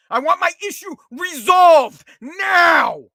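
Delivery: slow, high, loud shouted demand; angry demand. Real, not acted. Customer demanding support, shouting slowly and furiously. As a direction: bang out every word like an order. Ranting about unreachable phone support.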